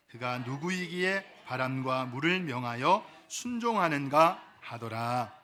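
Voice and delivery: solemn, measured Bible reading; scripture reading. Acted. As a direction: read the passage out with reverent, drawn-out phrasing. Reads scripture aloud.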